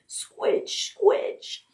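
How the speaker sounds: breathy high whispered squeak; soggy toy duck squeak. Acted. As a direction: hiss it like a waterlogged squeaker. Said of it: "all he could say was".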